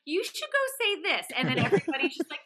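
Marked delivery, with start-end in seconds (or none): 0.1-1.3 high-pitched mischievous urging; pitched-up goading, playful; egging on. Retold or imitated. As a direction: imitate friends egging someone on. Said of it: "your friends are like".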